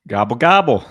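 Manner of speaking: loud mock turkey gobble. Co-host greets host with a loud turkey gobble.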